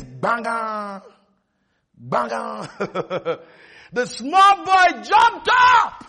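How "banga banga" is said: banga, banga rung out like a clanging bell; imitated school bell. Preacher imitates the school bell to his congregation, mock clang. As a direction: mimic the school bell ringing for the end of class. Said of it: "rang the bell like this".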